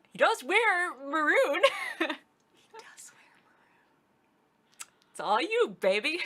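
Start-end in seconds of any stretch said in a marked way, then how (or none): 2.1-3.6 whispered. Real, not acted. Repeat whispered after saying it.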